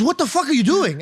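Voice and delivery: mock exasperation; lightly voiced angry father; angry dad. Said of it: "He goes".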